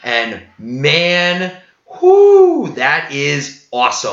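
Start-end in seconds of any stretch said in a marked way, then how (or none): 2.0-2.7 high, loud, held "whoo"; excited whoop. Real, not acted. Thrilled fan cheering Heartsbane, excited whoop. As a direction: let out a long, high whoop.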